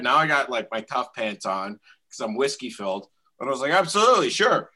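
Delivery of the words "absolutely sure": high, loud cocky agreement; eager mock yes. To the guy inviting him outside, a high cocky yes. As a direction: throw it out like a whiskey-brave idiot. Drunk narrator accepts fight, retold.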